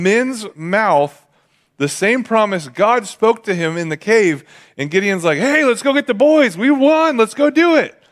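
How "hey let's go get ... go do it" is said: much higher, louder thrilled shout; excited. Retold or imitated. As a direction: burst out with the news of victory. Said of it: "And now Gideon's excited".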